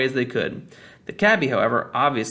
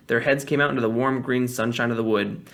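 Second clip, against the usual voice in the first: ordinary delivery, nothing marked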